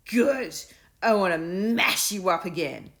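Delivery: loud, high, relishing bully voice; gleeful bully. A narrator's reading. Bully boasting to Will, loud and gleeful. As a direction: savour every threat like a treat. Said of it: "said Bartholomew with relish".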